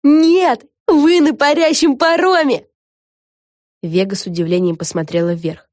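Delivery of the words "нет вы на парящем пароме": high, loud, drawn-out cry; exclaiming. Acted. Correcting someone aboard the ferry, exclaiming loudly. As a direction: call it out loud, stretching the words.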